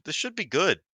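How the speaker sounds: expectant. Retold or imitated. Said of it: "I was like".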